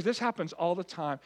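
usual speaking voice

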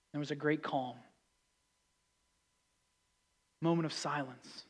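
soft, low, settled calm; quiet and spacious; hushed. Real, not acted. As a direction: let a stillness fall over the words.

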